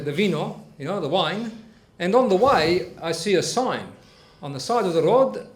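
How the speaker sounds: lightly voiced Italian guest. Guest [name] telling the host a joke, lightly Italian. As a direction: tell it as the visiting Italian uncle.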